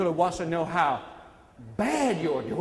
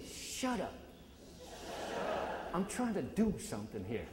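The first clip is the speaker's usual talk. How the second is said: crowd repeats quietly; 2 semitones lower, 13 dB quieter than usual. Shown as a demonstration. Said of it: "I want you to say that".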